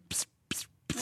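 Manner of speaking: whispered psst gunshot noises; voiced silencer shots; silenced gunshot sound. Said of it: "that gun's like".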